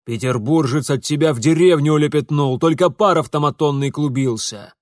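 loud scornful father's rebuke; angry jeering. Acted. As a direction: throw it in her face loudly. Father mocks his daughter angrily.

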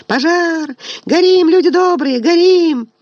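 very high shrill screaming; piercing shriek. Acted. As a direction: shriek fire at the top of her lungs. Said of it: "пронзительно заверещала". (пронзительный визг)

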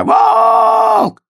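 very high, loud, drawn-out cry; громкий крик. A narrator's reading. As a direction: shriek it out, stretching the vowel. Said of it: "ещё громче крича". (истошный протяжный крик)

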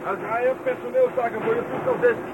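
high, far-off voice through the line; phone operator. Acted. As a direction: announce the call like an operator. Operator pages over the phone.

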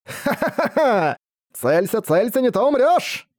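высоко, с дребезжащим хохотом; скрипучий смех ожившего посоха; хохочущий говорящий жезл. Acted. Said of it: "Стуча челюстью, сказал жезл мудрости". (высокий хохот волшебного жезла)

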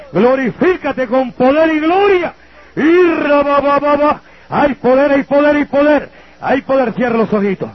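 high, loud revival climax; preaching at full force. Acted. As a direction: lift the room with power and glory. Clímax de oración con lenguas. (clímax de predicación, alto y fuerte)

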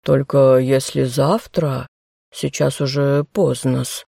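low, slow, obsequious clerk; servile clerk voice. Acted. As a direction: drawl it out like a fussy servant. Clerk answering through artefact.

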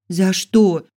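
loud, high cry of protest; indignant protest. A narrator's reading. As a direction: protest the unjust punishment. Heroine protests her punishment. (возмущённый протест)

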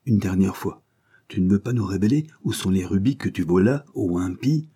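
ordinary delivery, nothing marked

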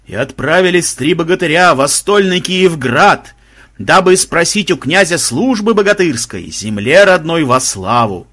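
loud, slow, drawn-out ghostly proclamation; sepulchral wail. A narrator's reading. Unseen voice narrating three heroes' quest, sepulchral howl. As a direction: intone it slowly like a voice from the grave. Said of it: "взвыл замогильный голос".

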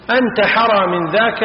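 ordinary talk, nothing marked

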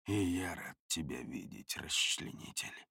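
very low, hushed grumble; grudging greeting, grumbled very softly; muttered grumble. A narrator's reading. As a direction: mutter it under your breath, barely voiced. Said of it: "буркнул я".

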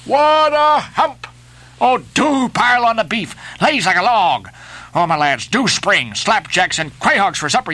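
high, pleading excitement in bursts; hopping-up-and-down eagerness, pitch leaping; excited cry. A narrator's reading. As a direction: beg your lads frantically to pull. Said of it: "cried Flash, dancing up and down".

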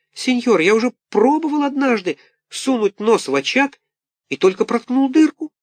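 put-on very high, wailing small-boy voice; pitiful little puppet. A narrator's reading. Buratino pleads with Karabas, high wailing. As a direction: wail like a poor orphan puppet.

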